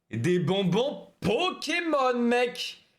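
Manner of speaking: « Pokémon » étiré, aigu et lent; annonce hypée. Acted. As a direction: lancer « bonbons Pokémon » comme un présentateur. Annonce théâtrale, reprise plus haute. (« Pokémon » lent, voix montée)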